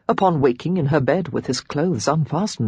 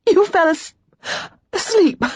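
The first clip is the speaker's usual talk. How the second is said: much higher, laughing through the words; breaking up with laughter, pitched way up; hiccuping with laughter; 4 dB louder than usual. Acted. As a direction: squeak it out through giggles. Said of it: "She hiccuped with laughter".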